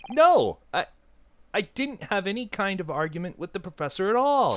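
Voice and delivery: loud, high denial; accused shouting his innocence; alarmed protest. Acted. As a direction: cry out in panic.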